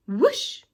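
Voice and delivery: loud rising whoosh; excited sliding whoosh. Acted. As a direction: swoop up through a big whoosh. Sliding sound effect.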